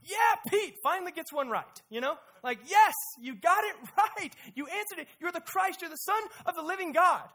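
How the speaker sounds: hammed-up joyful celebration; mock cheering. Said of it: "we're all like".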